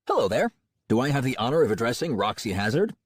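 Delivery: slick, confident cold-caller; a touch of a smooth salesman. A narrator's reading. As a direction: greet her like a salesman on the phone. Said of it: "A professionally warm and confident voice, like a cold-calling salesman".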